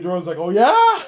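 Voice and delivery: high laughing squeal. Real, not acted. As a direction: laugh through it. Laughing retell.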